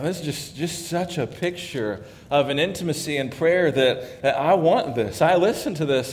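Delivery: usual speaking voice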